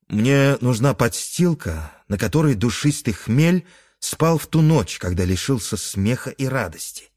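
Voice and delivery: ordinary delivery, nothing marked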